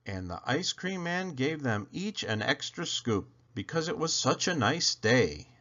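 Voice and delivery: ordinary delivery, nothing marked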